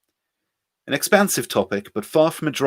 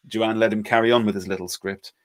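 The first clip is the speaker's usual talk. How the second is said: usual speaking voice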